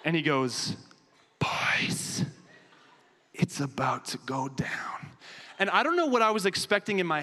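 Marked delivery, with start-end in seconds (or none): 1.4-5.1 whispered, slow and secretive. Retold or imitated. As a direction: breathe it like a secret warning. Sneaking up at night, door prank.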